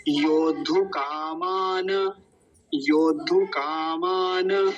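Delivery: "योद्धु कामान" slow, final na voiced fully; full na, joined. Shown as a demonstration. Teacher joins the two parts, slow careful demonstration. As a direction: sound out "योद्धु कामान" as yod-dhu kaa-maa-na. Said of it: "क्योंकि न पूरा है".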